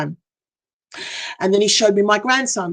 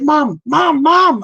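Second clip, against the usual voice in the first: rising, louder calls of Mum; calling out; 8 semitones higher, 5 dB louder, much slower than usual. Retold or imitated. Son calling his mum back as she leaves, louder each time. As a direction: call her back, more urgent each time.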